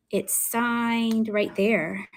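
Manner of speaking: "signed" stretched out long, very slow; slow, lingering "signed"; drawn-out showing off. Real, not acted. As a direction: linger on "signed" while pointing it out. Showing a signature, drawing it out.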